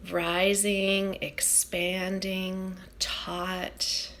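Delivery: hushed, unhurried breath-by-breath guiding; guided meditation. Acted. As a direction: guide the breath gently, phrase by phrase, with long pauses.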